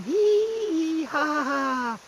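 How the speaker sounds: very high, mocking hee-hee laugh; shrill imitation of giggling college kids; squealing giggles. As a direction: ape the kids' squeaky laughing. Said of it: "right as I'm going to sleep again".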